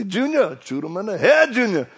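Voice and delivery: high, loud bayou holler; swamp man shouting. Retold or imitated. TV swamp man yelling at Junior, loud and high. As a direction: call out to Junior across the swamp. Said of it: "I heard a guy say".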